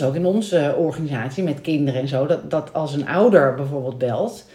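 normal talking voice

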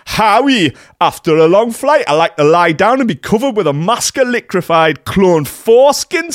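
high, loud hammed-up posh English accent; mock posh English accent quoting her caption. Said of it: "I'll do the accent".

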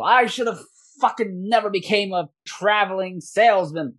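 high-pitched, cursing merchant's rant; exasperated yelling. Acted. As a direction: gripe loudly about a rotten career. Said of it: "You hear them yelling at each other".

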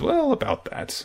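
very high, soft sheepish hedge. Retold or imitated. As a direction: squeak out an awkward dodge. Character dodges awkward question.

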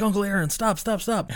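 high, desperate pleading. Retold or imitated. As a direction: beg him to stop. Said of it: "he's like".

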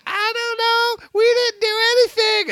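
high, slow whiny denial; innocent kids. Retold or imitated. Brothers caught over dirty dishes, whiny high denial. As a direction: play the guilty kids pleading innocence. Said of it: "they're both like".

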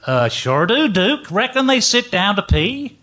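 imitated good ol' boy, rising to a high question; mock Southern drawl, pitch climbing; second Texan heckler. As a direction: answer like a Dallas yokel in the crowd. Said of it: "Another voice".

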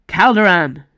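loud call across the rooftops; yelled name. A narrator's reading. Icaros yelling after a fleeing assassin, loud. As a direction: shout the name after him. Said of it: "he yelled".

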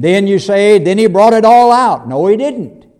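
building preacher retort; rising rebuttal. Acted. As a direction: challenge the congregation's assumption. Preacher rebuts imagined claim.

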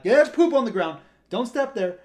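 loud, high retold warning call; urgent warning. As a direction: blurt it out to stop someone stepping.

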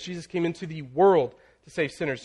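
world punched and lengthened, the rest light; stress on world. Shown as a demonstration. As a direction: land hard on the word world. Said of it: "each time you're putting emphasis on a different word".

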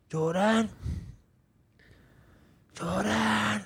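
drawn-out, high-pitched mock call for help; missus screaming out. Missus calling for him on a bad trip, imitated high wail. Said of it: "My missus is fucking screaming for me".

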